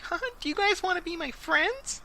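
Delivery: put-on high, eerie little girl; sing-song creepy child asking; high creepy girl voice from the cartoon. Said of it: "and they say".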